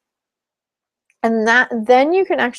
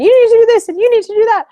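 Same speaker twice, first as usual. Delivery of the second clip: much higher, louder, fast angry demanding; 8 dB louder, faster than usual. Retold or imitated. Angry partner confronting therapist, shrill fast demanding. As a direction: hurl the demands at her fast. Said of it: "someone comes at me and they're angry".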